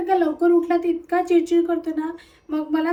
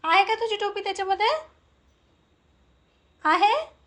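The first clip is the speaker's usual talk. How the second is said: ordinary talk, nothing marked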